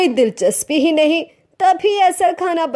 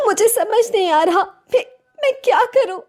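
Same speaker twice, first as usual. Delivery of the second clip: fast, choked words as she starts to cry; tearful, breaking down; 7 semitones higher, faster than usual. Acted. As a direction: voice climbs high and breaks into sobs. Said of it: "इतना कहकर ऐना फूट फूट कर रोने लगती है।". (रोते हुए, टूटती आवाज़ में)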